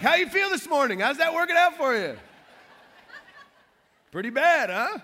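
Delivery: mocking trash talk. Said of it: "talk trash to lost people".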